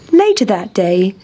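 ordinary delivery

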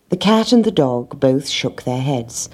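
usual speaking voice

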